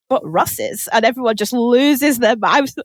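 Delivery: normal talking voice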